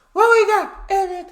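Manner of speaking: high, loud shouted intro; big high-pitched holler; hyped call-out. Real, not acted. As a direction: call out loudly to kick off the video. Hyped call to video's host.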